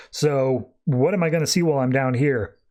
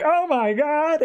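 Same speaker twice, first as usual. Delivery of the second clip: high, drawn-out mock shock; retold stunned gasp of words; 12 semitones higher, slower than usual. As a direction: stretch it out high in imitated disbelief. Shock at seeing pregnant belly.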